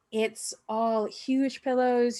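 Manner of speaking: usual speaking voice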